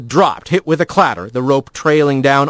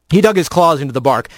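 usual speaking voice

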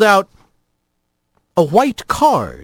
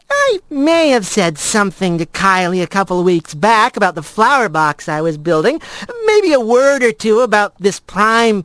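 lightly voiced, a bit higher, owning up by degrees; sheepish friend confessing; 6 semitones higher than usual. Blaze admits telling Kylie about Rat's new place, mildly sheepish.

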